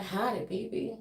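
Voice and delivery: lightly Southern, said softly; Southern howdy. Acted. Her own howdy to Matthew, softly Southern. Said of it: "but I'd be like".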